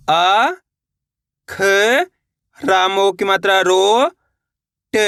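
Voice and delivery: isolated sounds with pauses, "रो" drawn out and rising; syllable-by-syllable spelling. Shown as a demonstration. Teacher leading learners to repeat along, slowly spelled out. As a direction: sound out "अ", "ख", "रो", "ट" one at a time. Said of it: "मेरे साथ साथ इनको repeat करना है".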